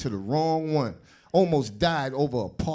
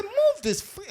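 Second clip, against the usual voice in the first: angry; slower than usual. Retold or imitated. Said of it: "I said".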